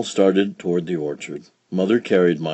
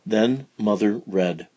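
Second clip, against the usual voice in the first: usual speaking voice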